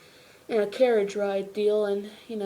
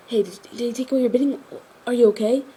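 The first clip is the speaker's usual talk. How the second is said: hurried, higher, stumbling worried question; urgent concern; 4 semitones higher, 5 dB louder, faster than usual. Retold or imitated. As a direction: imitate someone anxiously checking on a friend, louder at the end. Voicing Tenzin's urgent concern.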